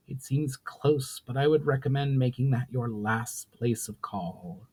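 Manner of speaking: even, measured storyteller's read; story narration. A narrator's reading. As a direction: tell the tale steadily to listeners.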